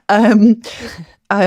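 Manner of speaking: loud laughing um; laughing off the dog's noise. Real, not acted. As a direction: burst into laughter mid-sentence. Laughing at dog interruption.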